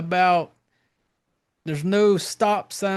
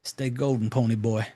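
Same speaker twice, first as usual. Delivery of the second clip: lightly put-on low, soft voice; quoted movie line; 7 semitones lower, 4 dB quieter than usual. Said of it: "was like".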